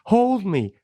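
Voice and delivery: high-pitched pleading child imitation; scared little daughter. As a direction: mimic a small child begging to be held. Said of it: "it's a little scary, and she goes".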